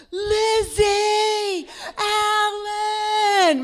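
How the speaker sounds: long drawn-out high shout across a crowd; shouting over a parade; yelling a name. Retold or imitated. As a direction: call her name out loud and long.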